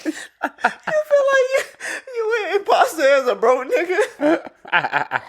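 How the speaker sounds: very high, drawn-out words through laughter; helpless laughter. Real, not acted. Host repeating friend's answer back in disbelief, helpless laughter. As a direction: wheeze the question out, barely coping.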